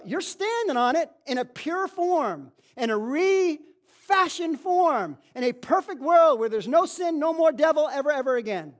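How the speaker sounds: loud, high preacher's cry; full-force preaching. Acted. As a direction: preach it hard to the congregation.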